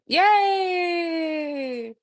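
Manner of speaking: high, long drawn-out yay; excited cheer. Real, not acted. Streamer celebrating a good new mic, excited cheer.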